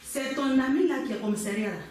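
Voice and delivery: normal talking voice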